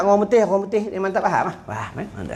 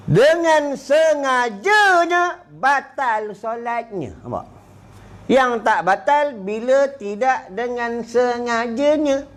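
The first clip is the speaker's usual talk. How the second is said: preaching emphasis; 5 semitones higher, much slower than usual. Acted.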